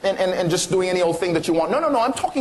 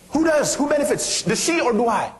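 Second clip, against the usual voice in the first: emphatic question; 6 semitones higher than usual. Retold or imitated. Quoting her remarkable answer.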